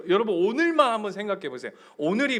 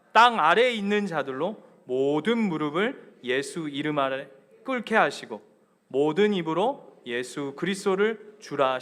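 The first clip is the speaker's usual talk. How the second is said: measured, emphatic Bible reading; congregational scripture reading; 2 semitones lower, 4 dB louder than usual. Real, not acted. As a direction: read scripture aloud to lead a congregation in unison. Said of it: "우리 한 목소리로 한번 읽겠습니다". (회중과 함께 읽는 힘찬 성경 봉독)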